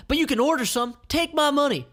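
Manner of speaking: high, loud eager plea; eager Eve. Acted. Eve begging to buy, loud and eager. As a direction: push your money at her. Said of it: "Eve replies".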